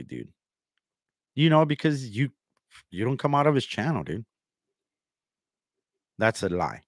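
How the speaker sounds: ordinary talk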